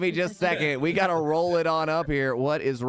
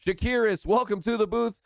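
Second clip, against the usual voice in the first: higher, pumped-up announcer roll call; stadium-style shout-outs, upbeat and higher; hype announcer; 8 semitones higher than usual. Acted. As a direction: call each name like a sports booth announcer. Hype-announces sub gifters by name.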